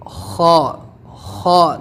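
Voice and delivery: "خا" pushed from the throat against the palate; drawn-out, throaty "خا"; throaty letter demo. Shown as a demonstration. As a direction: open the mouth a little and force out "خا" from the throat. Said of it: "تھوڑا منہ کھول کے ہم زور دیں گے اپنی حلق کی طرف سے".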